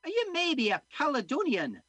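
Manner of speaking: high, loud Scottish woman asking; nosy Scots lady prying; Scottish accent. Acted. Missus Muldoon quizzes the doctor's origins, high Scottish accent. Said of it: "I'm gonna be doing a Scottish accent".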